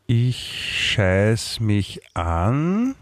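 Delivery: very slow, drawn-out title reading; slow reading aloud. Shown as a demonstration. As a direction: read the title off the screen slowly. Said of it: "Kolumnen und Zeichnungen von Clemens Heipel".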